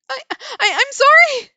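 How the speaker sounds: high, loud tearful apology; choked tearful cry. Acted. Narrator sobs an apology to the scolding woman, loud. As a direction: cry it out through tears. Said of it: "choked by tears and ragged".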